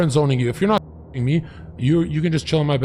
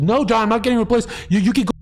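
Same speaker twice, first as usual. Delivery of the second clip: quick, high-pitched mock girlfriend; high girl voice; 9 semitones higher, faster than usual. Mocking the girl's reply on the call, high and fast. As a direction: rattle it off high like an offended girl. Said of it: "And she goes".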